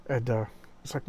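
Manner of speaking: ordinary delivery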